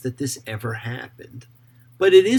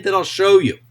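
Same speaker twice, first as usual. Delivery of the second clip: divine command; 7 semitones higher, 10 dB louder than usual. Acted. God's command read with force.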